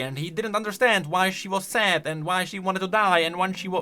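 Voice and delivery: ordinary talk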